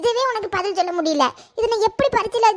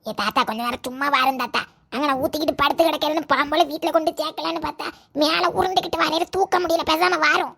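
strained low grumble; 5 semitones lower than usual. Acted. Grandson hauling drunk grandpa.

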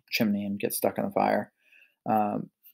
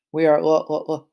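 higher-pitched nervous stutter; stammering; 4 semitones higher, 4 dB louder than usual. A narrator's reading. Piglet explains himself to the wolf, stammering. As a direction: get stuck repeating the first syllable. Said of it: "stammered Freddy".

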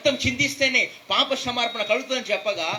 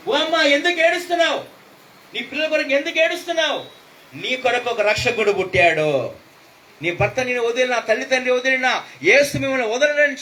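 urgent comfort; 4 semitones higher, 5 dB louder than usual. Retold or imitated. Stranger comforts weeping grandmother.

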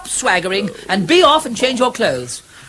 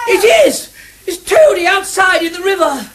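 louder and much higher, bursting with recognition; excited exclamation; 9 semitones higher, 5 dB louder than usual. Acted. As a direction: call out the discovery with a shout. Ratty recognises Toad in river.

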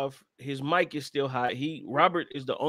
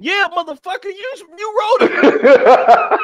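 high, loud yell breaking into laughter; mock exasperated outburst; 13 dB louder, slower than usual. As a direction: shout the accusation, cracking up as it slows. Mock outburst at the story's subject.

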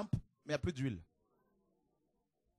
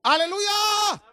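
high ringing alleluia shout; 11 dB louder, much slower than usual. Real, not acted. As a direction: lift the crowd with a big alleluia. Preacher's shouted acclamation. (alléluia crié à pleine voix)

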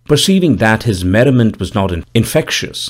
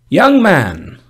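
put-on old gravedigger voice; 7 semitones higher than usual. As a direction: speak as a dour old gravedigger, raised pitch. Gravedigger speaks in story.

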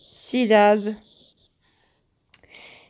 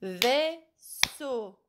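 Vaisseau softly split into two clapped beats, vais-seau; clapping syllables; 2 semitones higher, 9 dB quieter than usual. Shown as a demonstration. Teacher clapping out Vaisseau so pupils can listen. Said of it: "Écoutez".

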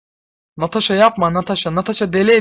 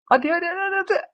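much higher "hadi" chant; excited urging; 13 semitones higher, much faster than usual. Real, not acted. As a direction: egg them on. Urging units in game. (heyecanla hadi hadi)

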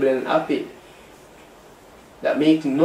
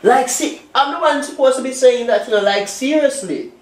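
indignant; 9 semitones higher than usual. Acted. She throws his words back.

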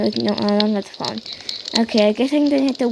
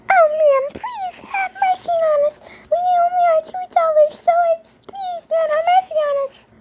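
much higher, tearful begging voice; pleading beggar. Acted. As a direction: beg for mercy in a high crying voice.

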